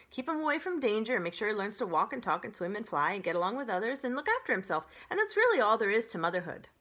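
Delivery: fast, loud, breezy list; breezy goose. Acted. Goose rattling off advice.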